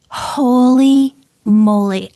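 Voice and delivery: slow, loud gasp of amazement; stunned holy moly; amazed. Real, not acted. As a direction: let the words drag out in disbelief. Amazed at the doctor's words.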